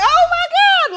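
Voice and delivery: high, loud shocked Oh my God; retold shock. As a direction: gasp it out like you just heard something wild. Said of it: "I was like".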